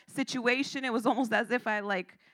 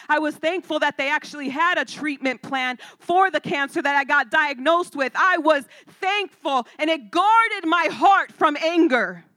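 louder, higher preaching that builds; rising preaching; 4 semitones higher, 8 dB louder than usual. Acted. Pastor stirring up the congregation: louder, building preaching. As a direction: preach it with growing force.

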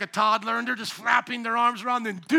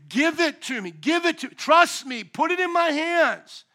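higher, louder, pressing invitation; God's urgent plea; 7 semitones higher, 7 dB louder than usual. Retold or imitated. God urging the grieving to give him their pain, raised. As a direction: reach out and urge them to hand it over.

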